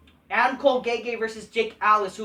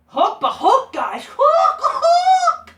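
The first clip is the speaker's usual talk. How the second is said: high loud cheering chant with laughter; squealing Hook chant; excited cheer; 10 dB louder, much slower than usual. Real, not acted. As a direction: cheer the name like a fan.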